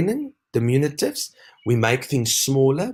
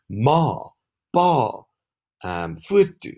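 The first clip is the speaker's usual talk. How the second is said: "Ma, pa" each stressed on its vowel, set apart; emphasized final vowel; 7 semitones higher, much slower than usual. Shown as a demonstration. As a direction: lean on the vowel in "Ma, pa". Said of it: "ends in a short emphasized vowel".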